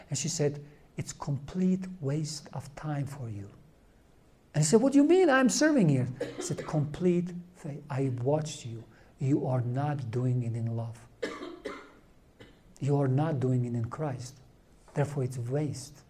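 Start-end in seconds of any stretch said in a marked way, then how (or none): 1.0-3.5 very soft, near-whispered retold reply; quietly imitating a nun's blunt answer; hushed verdict. 4.8-6.1 high, quick retold protest; baffled, exasperated objection; irritated. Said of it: "It irritates me". 6.7-16.0 soft, low retold verdict; quiet rebuke. Retold nun's calm, grave rebuke.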